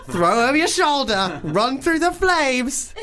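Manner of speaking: stretched-out, laughing action-hero urging; mock-heroic excitement. Host narrates the fire rescue, mock-heroic high excitement.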